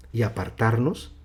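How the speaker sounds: normal talking voice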